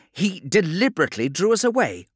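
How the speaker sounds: loud, high-pitched exclamation; indignant realisation. A narrator's reading. Redloss realising they were drawn away, indignant. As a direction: raise the voice on the dawning deception. Officer reacts to the deception.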